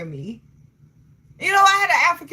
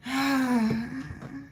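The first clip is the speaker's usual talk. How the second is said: long, soft exhaled sigh; 6 dB quieter than usual. Real, not acted. Streamer hesitating over African men, long soft sigh. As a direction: let out a drawn-out sigh.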